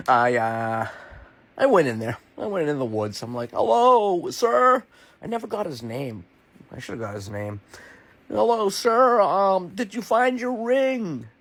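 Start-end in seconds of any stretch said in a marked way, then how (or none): 3.5-4.8 high, loud call into the woods; hailing someone, loud and high. Retold or imitated. Calling out to stranger in woods. 8.3-11.3 high, loud call, slow question; hailing into trees, drawn out. Retold or imitated. Calling out again in woods.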